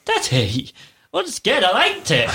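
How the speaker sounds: high-pitched imitation of a small girl; little daughter. Daughter insisting to her dad, mimicked in a high child's voice. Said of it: "And she said".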